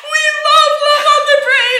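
very high, loud mock squeal; imitated group cheer. Whole staff joining in on the drive, ecstatic shout. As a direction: sing it out like an overexcited group.

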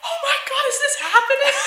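high, loud, giddy exclamation; imitated thrilled disbelief. Two friends running into the sea, thrilled disbelief. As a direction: squeal it like overjoyed friends.

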